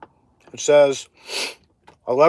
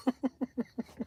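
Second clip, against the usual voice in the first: breathy laugh; 8 semitones higher, 16 dB quieter than usual. Real, not acted. Laughs at own boast.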